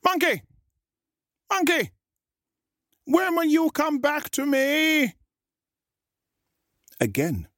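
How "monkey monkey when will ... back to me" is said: shark shouting. A narrator's reading. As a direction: call out high and long. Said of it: "Then he shouted again".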